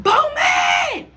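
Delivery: high, loud call across the house; mock yell. Mom calling out for Bowman with a high yell. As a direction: yell a name down the hall. Mom yelling for her husband.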